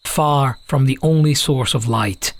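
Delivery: ordinary delivery, nothing marked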